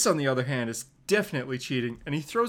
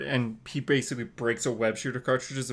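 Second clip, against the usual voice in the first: ordinary talk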